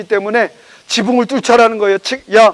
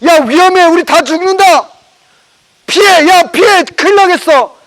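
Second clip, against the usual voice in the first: higher, louder panicked warning cry; alarmed shouting; 7 semitones higher, 4 dB louder than usual. Acted. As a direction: shout at everyone to get out of the way. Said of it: "사람들은 욕을 하기 시작해요".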